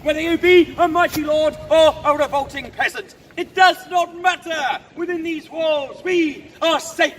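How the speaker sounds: loud, emphatic stage proclamation; rally the garrison behind the walls; rousing defiant oratory. Acted. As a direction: declaim it to the whole crowd. Guard defies attackers before the crowd.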